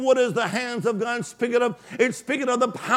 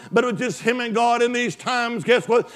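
normal talking voice

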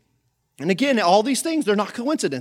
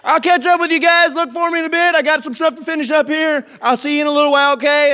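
high, loud, sustained calling; calling from afar; 8 semitones higher, 9 dB louder than usual. Acted. Jesus on shore to disciples in the boat, calling out. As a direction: shout across water to a departing boat.

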